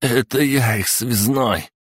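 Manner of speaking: usual speaking voice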